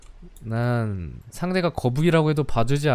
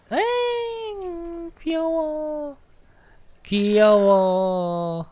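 cooing; much slower than usual. Real, not acted. Cooing over cute turtle.

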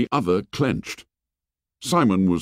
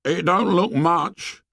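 whiny schoolboy; 9 semitones higher, slower than usual. Acted. Piggy voiced in story.

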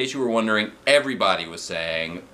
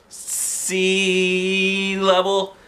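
very slow drawn-out high sea level; drawn-out plea; 10 semitones higher, much slower than usual. Acted. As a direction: stretch it, pleading for a laugh. Begging for a laugh.

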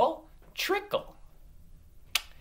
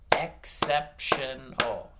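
"Exceptional" slowly split into four beats; "Exceptional" in slow, distinct syllables; slower than usual. Shown as a demonstration. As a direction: clap out "Exceptional" with each syllable separate. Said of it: "you gotta clap it out".

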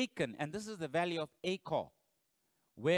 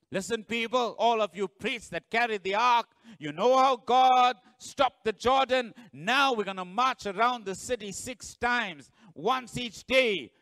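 loud, rising call to the people; rally the crowd like a leader; commanding Joshua; 6 semitones higher, 8 dB louder than usual. Acted. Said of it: "and Joshua says".

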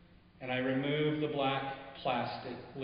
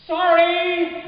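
much higher and louder, sung-out call of sorry; yelled apology; 14 dB louder than usual. Acted. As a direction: shout it after her as she runs off. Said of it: "you yell".

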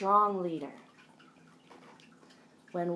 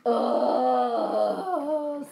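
exasperated; 3 semitones higher, 6 dB louder than usual. Acted. Mole rats exasperated.

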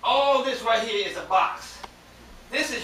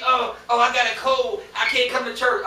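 mock excuse-making; 2 semitones higher, 7 dB louder than usual. Mocks an excuse for missing church.

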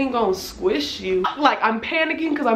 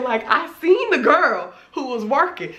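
ordinary talk

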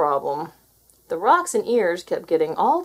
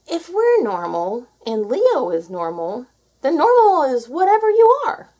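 delighted realization; 5 semitones higher, 7 dB louder than usual. Acted. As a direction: build to a joyful eureka.

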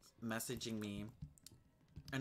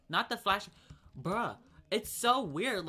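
indignant outburst; 6 semitones higher, 7 dB louder than usual. Real, not acted. Indignant outburst about being flashed.

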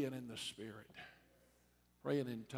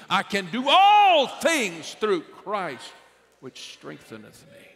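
slow, much higher and louder on all things; said with belief; 7 semitones higher, 18 dB louder than usual. Shown as a demonstration. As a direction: declare it slowly, full of conviction. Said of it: "you need to say it with belief".

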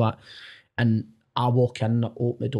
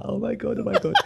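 giggly mock gasp, pitched up; laughing; 9 semitones higher, 5 dB quieter than usual. Imitating the crew laughing at the prank call. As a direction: laugh it out like you're in on the joke.

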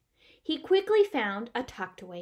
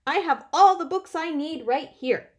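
loud, higher-pitched insistent Bear; emphatic announcement; 7 semitones higher, 11 dB louder than usual. A narrator's reading. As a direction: insist firmly and loudly. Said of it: "Bear announced".

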